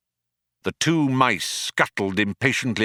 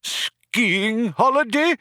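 high, drawn-out disbelief; old man crying out, scandalized; outraged cry; 3 dB louder, slower than usual. A narrator's reading. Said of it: "cried the old man".

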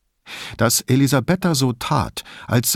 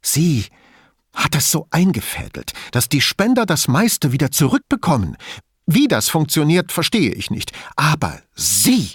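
high, indignant accusation with charged pauses; carried away by anger; 6 semitones higher than usual. A narrator's reading. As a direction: let the anger break through. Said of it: "ließ sich dann doch von ihrem Zorn hinreißen".

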